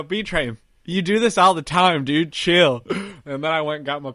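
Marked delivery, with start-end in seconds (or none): 0.0-2.8 louder self pep talk; loud coaching to yourself. Retold or imitated. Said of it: "And I go".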